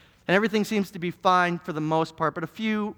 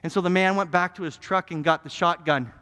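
usual speaking voice

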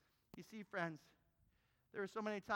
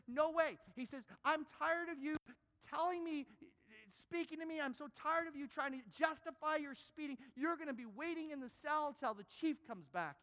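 high, loud angry cop; angry officer; 8 semitones higher, 3 dB louder than usual. Acted. Officer yelling at the pleading driver, furious and high.

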